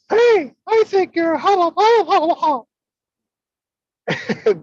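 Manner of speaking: high, loud squeaky cartoon mouse; mock the words as Mickey Mouse would; giddy cartoon falsetto. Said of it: "use the voice of Mickey Mouse".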